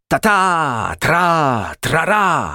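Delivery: high, sung-out bugle syllables; high trumpeting fanfare by voice; imitated trumpet call. A narrator's reading. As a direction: sound it out like a trumpet.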